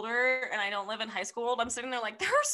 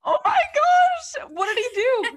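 gasping, high, loud, drawn-out exclamation; thrilled shock; 13 semitones higher, 8 dB louder, slower than usual. Real, not acted. Friend hearing a first-date story, thrilled shock. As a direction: squeal it, eager for the next detail.

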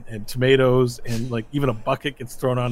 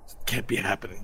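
low, soft disbelief; hushed, crushed denial; stunned muttering; 4 semitones lower, 6 dB quieter, faster than usual. Acted. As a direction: mutter it to yourself in defeat. Beaten fighter mutters in disbelief.

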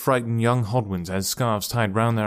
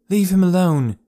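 protective; 8 semitones higher, 6 dB louder than usual. A narrator's reading. Alice defends Miles.

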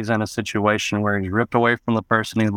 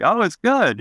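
high, coaxing imitation of dad; 11 semitones higher than usual. Dad insisting the tuna salad is good, high coaxing tone. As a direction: sell it like it's a treat. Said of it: "And he was like".

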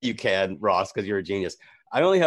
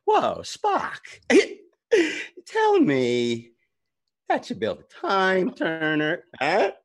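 celebrity impression; 5 semitones higher, slower than usual. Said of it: "And it goes like this".

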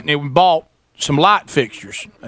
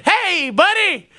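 drawn-out, very high-pitched hail; mocking call-out; 14 semitones higher, much slower than usual. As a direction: stretch it high to taunt him. Confronting late installer from car.

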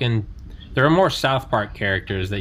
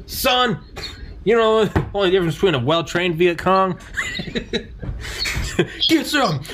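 mock drill sergeant; 8 semitones higher than usual. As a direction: bark it like an old war vet. Imitating Vietnam vet drill sergeant.

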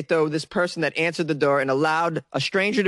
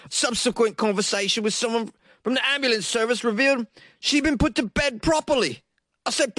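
higher-pitched put-on British accent, quoting the son; 6 semitones higher than usual. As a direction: read the son's quote in a mock British accent. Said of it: "I can't do this accent anymore".

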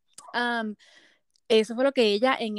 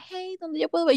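higher-pitched quoted hail; friend's eager question; 7 semitones higher than usual. Retold or imitated. Retelling a friend's message, excited higher voice. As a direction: call out as the curious friend.

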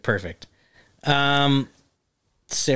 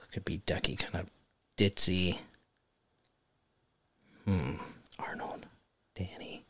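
near-whisper, musing to oneself; very soft, halting mumble; thinking aloud; 5 semitones lower, 11 dB quieter than usual. Real, not acted. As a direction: murmur the options under your breath. Thinking over casting to himself.

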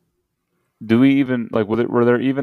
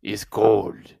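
mock Russian accent; slower than usual. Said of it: "How do you do a Russian accent?".

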